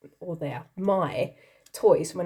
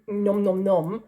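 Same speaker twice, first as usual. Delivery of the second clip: nom nom nom; 5 dB louder, slower than usual. Retold or imitated. Imitating eating cheese.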